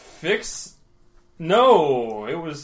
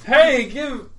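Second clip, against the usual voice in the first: high, loud indignant "hey"; loud shout; 12 semitones higher, 10 dB louder than usual. Acted. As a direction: yell at the thief. NPC demands gun back.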